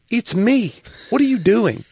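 high-pitched retold protest; exasperated friend. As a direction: imitate a friend fed up with being asked. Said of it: "I go, he goes".

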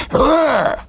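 mock beastly snarl of harsh delivery; dungeon dragon growl. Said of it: "Like a dungeon dragon".

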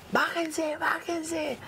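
high, held-back shout; retold mob order. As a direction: imitate attackers ordering people out.

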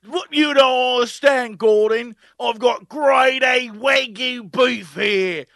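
loud, slow, stubborn bragging; mock boastful dad. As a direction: insist slowly like a proud blowhard. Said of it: "the dad's like".

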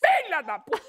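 high-pitched mock furious shout; hammed-up scolding yell for laughs; imitated aunt yelling. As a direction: burst out like an outraged aunt. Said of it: "Só escuta a minha tia assim".

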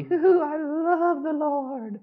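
high, slow, hammed-up whoop of devotion; mock an overexcited believer, way up high; mock gushing worshipper. Preacher mocking shallow emotional love of God, high slow whoop. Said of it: "was not the".

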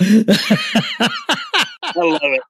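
real laughter, drawn out slowly; delighted, laughing through the words. Real, not acted. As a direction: let it spill out through the laugh. Reacting to a funny story.

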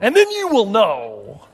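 high, emphatic mock threat; stern God. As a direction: dish out a divine threat, slowing at the end. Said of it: "that's how it reads".

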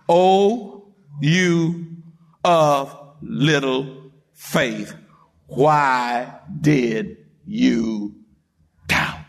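very slow, low, word-by-word reproach; slow rebuke. Acted. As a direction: weigh out each word as a grave Jesus. Acts Jesus rebuking Peter.

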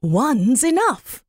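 a patient magpie answering calmly. Acted. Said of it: "So she said".

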